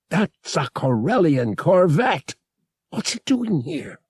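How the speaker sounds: high-pitched alarmed outburst. A narrator's reading. Pilot spotting the corvette, alarmed. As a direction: blurt it in sudden alarm. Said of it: "Finally alarmed".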